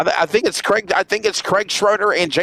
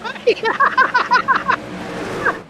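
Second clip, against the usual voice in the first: high laugh. Real, not acted. Laughs at the joke.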